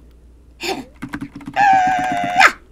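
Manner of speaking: high gasping cry of delight; excited shriek. Real, not acted. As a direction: shriek at the catch. Big catch.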